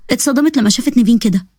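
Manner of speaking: ordinary talk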